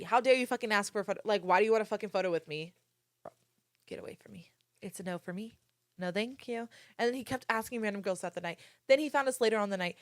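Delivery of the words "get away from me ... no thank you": very soft whispered aside; whispered rejection. Retold or imitated. Woman rejecting a guy wanting a photo, whispered dismissal.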